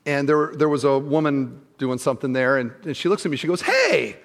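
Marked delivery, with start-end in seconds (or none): 3.6-4.2 cheery greeting. Retold or imitated. Woman greeting him in gym.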